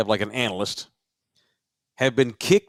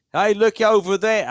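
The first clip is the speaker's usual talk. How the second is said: put-on a weak, half-hearted New Zealand accent; 7 semitones higher, 3 dB louder than usual. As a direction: try a Kiwi accent and miss. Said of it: "I can't do a New Zealand accent".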